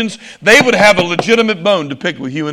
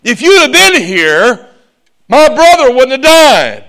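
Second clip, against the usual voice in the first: raised, higher preaching voice for an upset Martha; preacher acting out a reproachful complaint; Martha confronting; 6 semitones higher, 5 dB louder than usual. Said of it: "And here Martha said".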